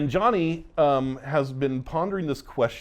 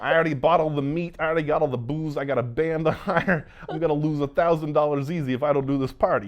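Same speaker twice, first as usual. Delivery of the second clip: higher and louder, a hammed-up indignant listing; mock exasperated rant; 5 semitones higher, 5 dB louder than usual. Owner insisting to the group that he won't cancel, hammed-up exasperation. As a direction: play the stubborn owner getting worked up, faster at the booze line.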